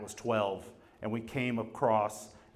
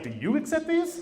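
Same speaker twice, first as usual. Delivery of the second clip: eager question; 13 semitones higher than usual. Retold or imitated. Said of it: "I was like".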